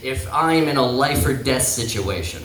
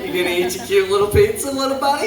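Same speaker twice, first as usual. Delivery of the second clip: quick, rising, higher-pitched taunt; baby him, climbing higher to the end; mock cutesy teasing; 5 semitones higher, faster than usual. Comic teasing his buddy about pizza, mock cutesy. Teases his friend, retold.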